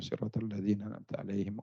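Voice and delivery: normal talking voice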